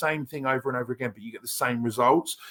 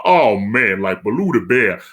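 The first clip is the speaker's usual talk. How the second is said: Southern American preacher; 3 semitones lower, 9 dB louder than usual. Shown as a demonstration. Actor showing his audition accent, loud Southern American preacher. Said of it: "like a Southern American preacher".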